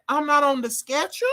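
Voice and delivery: high, questioning mock surprise; retold surprised question; acted disbelief. Acts out own reply to boss.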